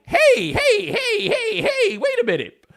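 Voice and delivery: very high, loud laughing hey hey; laughing mock alarm; alarmed protest. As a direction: stop her in mock panic. Panicking over the rabbit.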